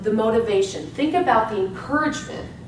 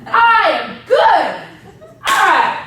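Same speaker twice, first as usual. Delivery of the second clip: higher and louder, slow, with a laugh; laughing affirmation; 9 semitones higher, 7 dB louder, slower than usual. Shown as a demonstration. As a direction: beam it at the mirror, slow and loud. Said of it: "go into the mirror and go".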